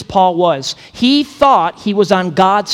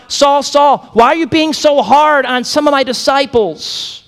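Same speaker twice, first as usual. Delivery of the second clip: higher and louder, fussy nagging tone; mock whiny Jesus; 9 semitones higher, 3 dB louder than usual. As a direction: nag like someone petty and fussy. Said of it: "He's not like".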